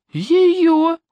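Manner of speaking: высоко и громко, поражённо; выкрикнуть в изумлении; изумлённый возглас. Acted. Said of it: "воскликнул двойник". (двойник изумлённо восклицает)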